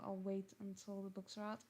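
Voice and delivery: normal talking voice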